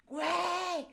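high mock yell at the driver, laughing; retold shout. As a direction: belt out a laughing warning cry. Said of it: "mi cuate que venía manejando gritaba". (grito de advertencia imitado, agudo y entre risas)

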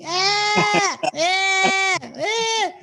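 high-pitched, loud mock scream of a kid; retelling a kid's shriek at a dog; imitated kid's scream. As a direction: shriek it like a panicking child. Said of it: "Started fucking high-pitched screaming".

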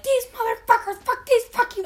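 high, loud furious swearing; screaming swears in the parking lot; retold screaming. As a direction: rage at the thieves. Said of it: "like, screaming".

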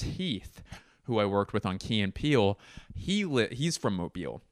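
ordinary talk, nothing marked

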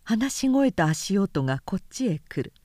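plain reading voice

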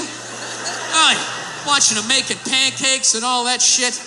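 ordinary talk, nothing marked

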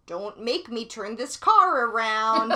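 put-on weary dad scolding squabbling kids; refereeing kids in car. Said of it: "[name] responded with".

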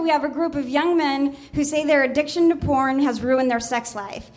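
normal talking voice

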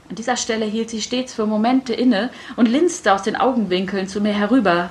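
plain reading voice

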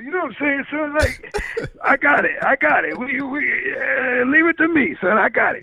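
high, cocky mock rapper voice; high-pitched mock street rapper. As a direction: play the boastful hater rapper. Doing the Mad Rapper voice.

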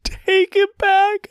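slow, very high desperate cry. A narrator's reading. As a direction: push the repeat harder, near wailing. Sobbing apology to the child.

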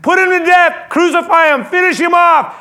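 loud, high, relentless mob shouts; mob shouting. Retold or imitated. Priests and guards shout down Pilate, furious yelling. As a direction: shout each demand like a crowd out of control. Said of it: "begin shouting out".

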